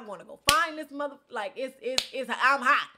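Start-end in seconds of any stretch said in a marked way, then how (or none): 2.1-2.9 angry. Real, not acted.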